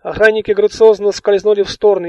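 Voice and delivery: ordinary delivery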